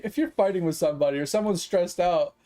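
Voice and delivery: ordinary talk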